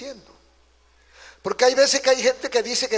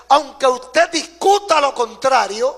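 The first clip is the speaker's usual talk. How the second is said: raised, louder preacher's voice; emphatic preaching; 5 semitones higher, 7 dB louder than usual. Real, not acted. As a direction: proclaim it forcefully to the congregation. Preacher's emphatic build before refrain.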